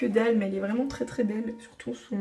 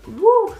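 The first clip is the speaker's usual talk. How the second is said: excited whoop; 11 semitones higher, 9 dB louder than usual. Real, not acted. Sudden exclamation at mirror.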